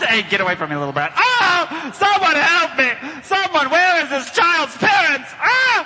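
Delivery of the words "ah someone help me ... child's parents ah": panicked mock yelling; mock cry for help. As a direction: shout for help like a cartoon villain. Calling for help.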